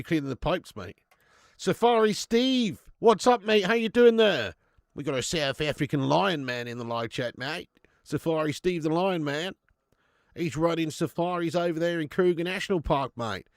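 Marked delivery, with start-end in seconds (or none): 1.6-13.5 hearty mock Australian accent, louder and higher at first. Streamer greets Safari Steve in the live chat, in a mock Australian accent. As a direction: put on an Australian safari-man voice. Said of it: "that's an Australian accent".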